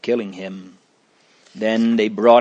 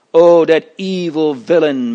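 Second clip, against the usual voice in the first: louder, higher exclamation of disgust; restrained yell; 5 semitones higher, 6 dB louder than usual. A narrator's reading. Juror denouncing the accused, restrained yell. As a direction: yell it mildly, leaning in at the start. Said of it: "Mister High Mind yelled".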